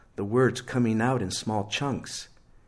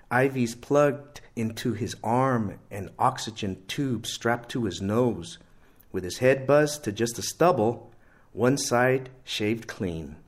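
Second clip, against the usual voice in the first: plain reading voice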